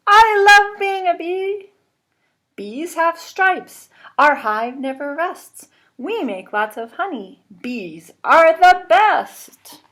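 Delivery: high, loud, excited chant; excited bee. Acted. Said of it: "Look how excited she is".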